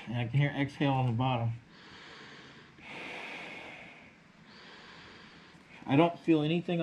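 muffled behind face mask; speaking inside respirator; through a gas mask. Real, not acted. Seen 2: Full-face gas mask worn over face throughout labelled words; refs unmasked.

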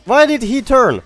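frustrated disbelief. Real, not acted. Frustrated at enemy turning.

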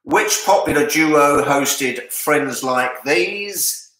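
ordinary talk, nothing marked

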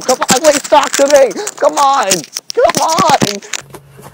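impatient, insistent begging shout; loud pleading. Real, not acted. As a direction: beg loudly, voice jumping up. Pleading loudly with ducks.

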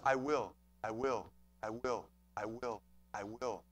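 very low, soft, even repetitions; hushed, low and unstressed I WILL; understated, unemphasized. Shown as a demonstration. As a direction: say I WILL quietly and low, no punch on either word. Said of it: "Emphasis on the I".

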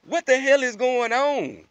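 high, loud, drawn-out disbelief; slow incredulous exclamation; baffled outburst. Real, not acted. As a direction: demand it in loud bewilderment.

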